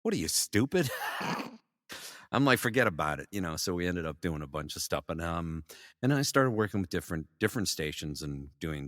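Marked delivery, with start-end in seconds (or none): 0.0-3.3 New York tough guy. Retold or imitated. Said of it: "I go".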